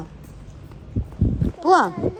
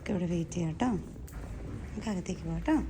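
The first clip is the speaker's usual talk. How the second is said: hushed; 9 semitones lower, 12 dB quieter than usual. Real, not acted. Hushed words to child.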